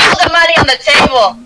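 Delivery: high-pitched dwarven demand; fierce dwarf woman. Acted. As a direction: order them to pay up as a tough dwarf. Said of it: "She's like".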